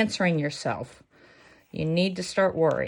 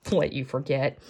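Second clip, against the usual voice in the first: ordinary delivery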